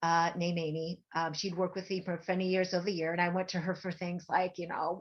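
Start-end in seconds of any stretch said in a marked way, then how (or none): none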